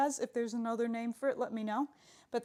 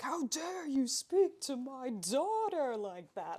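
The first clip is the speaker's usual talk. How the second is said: lightly voiced angry dad, slow; a hint of an indignant Barret, drawn out; imitating Barret; 3 semitones higher, slower than usual. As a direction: Barret scolding Cloud, lightly imitated in slow mock outrage. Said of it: "and he was like".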